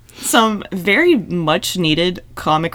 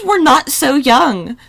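put-on slow, higher, laughing old-lady voice; mock old lady; 4 semitones higher, 4 dB louder, much slower than usual. Friend imitates Amy's wedding line, slow and laughing. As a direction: stretch it out like a fragile old woman. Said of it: "just the way you said that".

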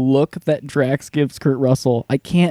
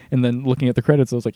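ordinary talk, nothing marked

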